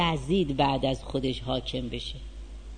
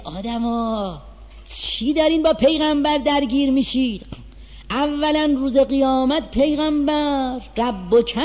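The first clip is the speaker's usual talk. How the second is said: higher, louder, driving sermon voice; fiery preaching; 6 semitones higher, 5 dB louder than usual. Acted. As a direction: preach it hard to the congregation. Preaching at full force.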